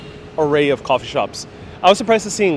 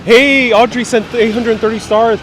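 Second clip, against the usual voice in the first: excited shout-out; 8 semitones higher, 8 dB louder than usual. Real, not acted. Thanking a viewer for stars.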